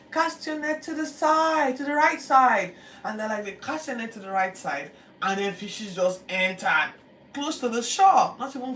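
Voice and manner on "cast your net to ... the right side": high, loud, slow call. Retold or imitated. Jesus calling to the boat from shore, slow loud call. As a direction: shout the instruction across the water.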